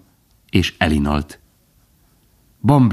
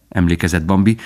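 ordinary delivery

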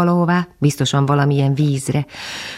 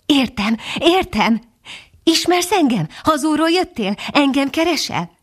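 eager excited dog voice; 10 semitones higher than usual. A narrator's reading.

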